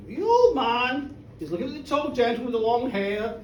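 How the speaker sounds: usual speaking voice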